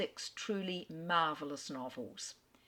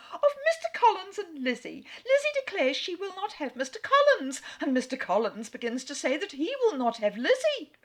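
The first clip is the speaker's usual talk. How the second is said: much higher, louder, half laughing and half wailing; frantic wife; 11 semitones higher, 7 dB louder than usual. Acted. The mother complains to her husband, much higher and frantic. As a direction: pour it all out in a flustered rush.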